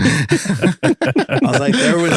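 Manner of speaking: laughing through the words. Real, not acted. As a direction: talk while cracking up.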